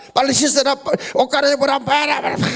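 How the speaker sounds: put-on high, fast nagging mother hurrying the kids. Mother rushing the kids in the morning, high fast nagging. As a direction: rattle off orders like a busy mother. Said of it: "이렇게 시작을 해요".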